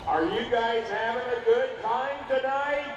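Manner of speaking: loud, slow, high hype call; crowd hype. Real, not acted. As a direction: pump up the crowd. Hyping crowd over PA.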